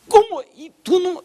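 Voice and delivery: very high incredulous mock question; shocked mother. Mother hears son knows nothing, high shocked disbelief. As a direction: imitate a mother aghast at the answer.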